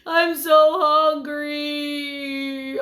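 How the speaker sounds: hammed-up crying child; mock crying whine. Shown as a demonstration. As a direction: whimper it like a crying kid. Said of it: "they just cry like that".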